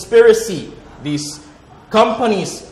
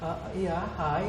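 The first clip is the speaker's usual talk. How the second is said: much softer, hesitant greeting; shy quiet Eve; 12 dB quieter than usual. Acted. Eve answering the serpent shyly and softly. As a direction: answer timidly.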